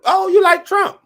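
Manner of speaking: high, loud mocking sneer; mock them in a high jeering voice; mocking quote. Streamer mocking critics' running joke, high sneering voice. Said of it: "That was like the running joke".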